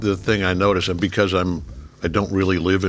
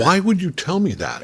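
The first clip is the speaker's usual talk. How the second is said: mock outrage; 11 semitones higher than usual. Mock outrage at bad news.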